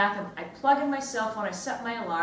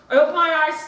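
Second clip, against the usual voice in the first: snapped out louder and higher, startled; sudden loud jolt; 6 semitones higher, 12 dB louder, much faster than usual. Acted. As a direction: burst out on the reveal. Suspense climax moment.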